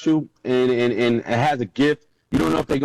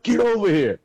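coach calling over; 8 semitones higher than usual. Retold or imitated.

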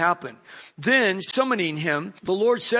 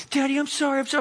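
apologetic daughter; 7 semitones higher, much faster than usual. Retold or imitated.

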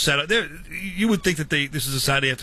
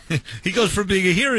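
laughing; 5 semitones higher, faster than usual. Real, not acted. Laughing while telling Gary story.

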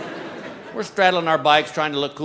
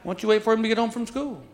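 imitating wife; 3 semitones higher, 7 dB quieter than usual. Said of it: "my wife goes".

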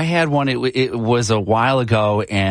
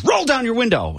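imitating an angry cop, pitched much higher; 12 semitones higher than usual. Retelling the angry cop at the Jeep window, high and sharp. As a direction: snap the order like a fed-up officer. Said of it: "he was so angry".

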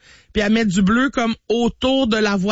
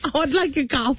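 quieter echo in a put-on British accent; mock British accent; 3 semitones higher, 3 dB quieter than usual. Host echoing the mother's order, mock British accent. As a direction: repeat the order like a Bridgerton lady. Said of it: "d'où vient cet accent-là, la Bridgerton".

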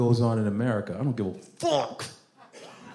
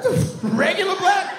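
high, loud incredulous shout; outraged shout; 4 dB louder than usual. Retold or imitated. Comedian repeating the insult to the crowd, shouting. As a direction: yell it in disbelief, laughing.

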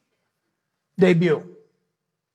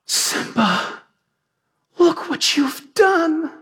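breathy then sharply higher, slow fake dismay; Scar's false pity; 11 semitones higher, slower than usual. Scar blaming young Simba after the king dies, in mock horror. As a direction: feign shock to pin the blame on Simba. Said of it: "Scar turns right then and there and says".

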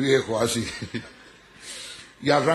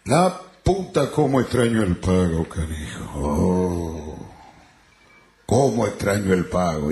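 drawn-out lament sinking very low; swearing gaucho wail that drops deep; homesick criollo; 8 semitones lower, slower than usual. Acted. Criollo at the bar with gin, long sinking lament. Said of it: "Decía el criollo".

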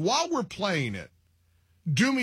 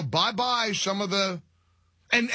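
normal talking voice

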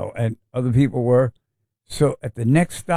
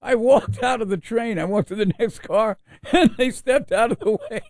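laughing through it; 10 semitones higher than usual. Real, not acted. Laughing while finishing story.

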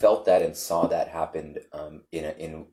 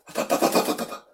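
rapid breathy stuttered "up" sounds; voiceless staccato puffs, as if seized by waves; imitated convulsive gasps; faster than usual. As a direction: act out a body shuddering. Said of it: "waves going through him".

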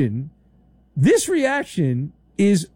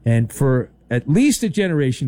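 ordinary delivery

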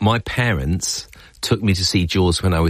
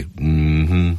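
low, drawn-out hum; imitative hum; 5 semitones lower than usual. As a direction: hum it low as a mock sign of dread. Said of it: "tell me somebody who doesn't go".

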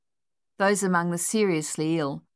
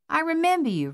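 high and tender; 11 semitones higher, faster than usual. Acted. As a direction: greet an old friend with delight. Nun greets the dog warmly.

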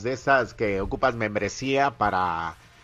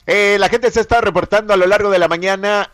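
voz de locutor, animada y fuerte; locución de radio; 7 semitones higher, 8 dB louder, faster than usual. Acted. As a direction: anuncia a la audiencia con energía. (locutor de radio entusiasta)

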